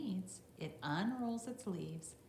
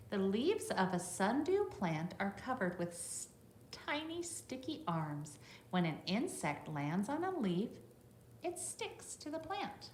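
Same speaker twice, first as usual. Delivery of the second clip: bright read-aloud voice for a class; reading to children; 3 semitones higher, 3 dB louder than usual. Acted. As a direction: read it out clearly for the little ones. Reads the book text to children.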